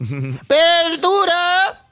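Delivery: drawn-out street vendor cry; market vendor shout. Retold or imitated. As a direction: call it out like a market seller. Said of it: "ha de haber gritado". (pregón de mercado alargado)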